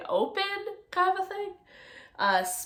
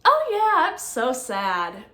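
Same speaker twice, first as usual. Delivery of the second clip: bright, much higher and louder "Oh yeah", then a slow "I'm so sad"; inappropriately cheerful; 4 semitones higher, 5 dB louder, slower than usual. Shown as a demonstration. Lecturer demonstrating mismatched affect, sad words said cheerfully. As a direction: chirp happily about being sad. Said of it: "inappropriate would be".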